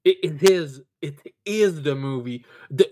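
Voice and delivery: ordinary delivery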